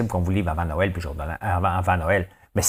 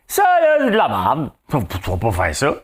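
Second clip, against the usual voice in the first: scornful relative; 4 semitones higher, 4 dB louder than usual. Retold or imitated. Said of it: "Surtout la famille au début".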